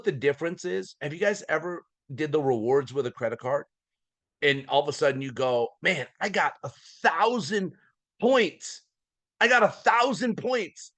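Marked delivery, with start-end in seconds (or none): 5.8-10.9 high, proud crowing over points; mock excited bragging. Customer showing off his points, mock excited. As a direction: brag like a thrilled cardholder.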